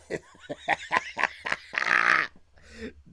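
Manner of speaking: high, loud breathless laugh fit; loud wheezing laugh. Real, not acted. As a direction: lose it completely, loud wheezing. Cracking up at the sword.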